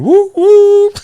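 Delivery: very high, loud sing-song whoop; radio ad jingle. Retold or imitated. Dad mimics the annoying air train ad, high loud whoops. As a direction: belt it like a cheesy radio jingle. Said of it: "that one will ring a bell ... radio ad".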